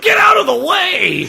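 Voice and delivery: very high, loud panicked yell; hammed-up warning shout. Train conductor to cinema audience, mock panicked scream. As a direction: scream it at a crowd in mock alarm.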